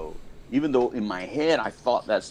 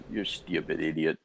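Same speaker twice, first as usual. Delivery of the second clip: imitated dad, low and soft; lightly voiced dad, dropped low and muted under the breath; dad's put-down; 5 semitones lower, 5 dB quieter than usual. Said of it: "my dad just used to look at me and goes".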